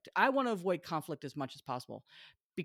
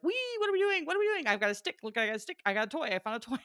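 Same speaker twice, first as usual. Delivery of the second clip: bouncy dog voice; 8 semitones higher, 4 dB louder, much faster than usual. Retold or imitated. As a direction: gush like a hyper puppy, much higher at first. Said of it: "She's like".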